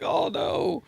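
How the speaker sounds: high-pitched mock "uh-oh" reaction; hammed-up alarm; retold dismay. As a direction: imitate their dismay, way up high.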